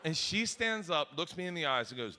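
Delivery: ordinary delivery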